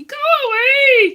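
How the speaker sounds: high, loud, very slow squeak; squeaky go-away voice. Pretend. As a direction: drag it out in a silly squeak. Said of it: "everyone else will have the same voice".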